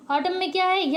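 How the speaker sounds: usual speaking voice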